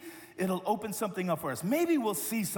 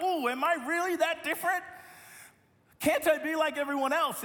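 higher, pleading, worried voice; mock anxious whine; 7 semitones higher than usual. Pastor playing his insecure self, a touch of mock worry. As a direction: fret about being different. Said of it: "I was anxious".

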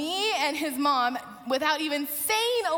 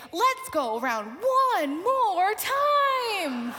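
slow, higher, stretched-out announcer cry; announcing call-out; 5 semitones higher, 3 dB louder, much slower than usual. Retold or imitated. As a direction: hype the riders, drawing out each word. Ride workers calling out to riders.

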